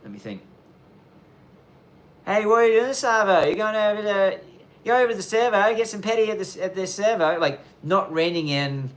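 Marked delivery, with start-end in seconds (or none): 2.2-7.4 high, loud, drawn-out Aussie Bogan voice; exaggerated drawn-out Australian slang, high and loud; Aussie Bogan accent. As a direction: ham up a broad Bogan Aussie. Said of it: "that accent that is really, like, drawn out".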